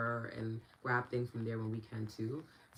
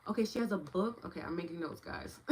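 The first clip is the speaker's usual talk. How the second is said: ordinary talk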